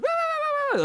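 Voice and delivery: very high wah-wah nagging; mock mom nagging noise. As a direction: squeak out a mom's fussing. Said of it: "My mom's like".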